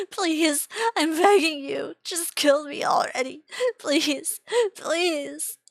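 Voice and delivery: loud, high desperate begging; screaming plea. Acted. Victim to his torturer, screaming and begging. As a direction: scream for death at full pitch. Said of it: "I can't stop myself from yelling at her".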